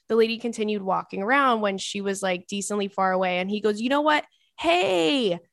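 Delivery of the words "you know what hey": imitated calling-out, higher pitched; mock yell. Date calling back the rose seller, a higher mock yell. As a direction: call out across a restaurant, hammed-up. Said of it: "and starts yelling".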